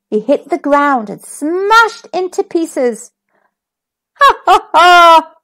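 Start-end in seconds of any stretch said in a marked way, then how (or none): 4.2-5.3 loud, high cackling laugh; dog laughing. A narrator's reading. The little dog laughing at the broken egg. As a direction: laugh at Humpty's fall. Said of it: "laughed the little dog".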